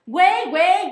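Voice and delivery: "güey, güey" as a furious mock bark; shouted güey. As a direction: explode on "güey, güey" as if fed up. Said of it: "Ladré con todas mis fuerzas".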